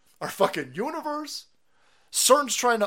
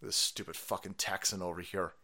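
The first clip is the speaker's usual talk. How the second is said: mocking critics; 9 semitones lower than usual. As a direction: sneer like a smug critic, dropping low. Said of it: "And they're like".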